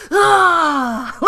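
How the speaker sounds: high, loud mock scream; sudden hammed-up "ah"; imitated scream. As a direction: scream it out like a jump scare. Imitates scream of leaping Brock.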